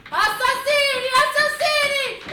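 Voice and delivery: shrill, very loud high screams of accusation; desperate screaming. Acted. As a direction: scream it at the killers from the window. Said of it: "non riescono a smettere di gridare".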